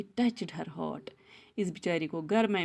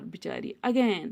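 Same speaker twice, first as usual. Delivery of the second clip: ordinary delivery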